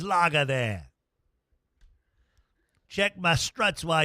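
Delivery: a light Australian accent, playing it broad; mock Australian accent. Radio host pretends to be an Australian ordering beer, mock Australian accent. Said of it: "Australian for beer".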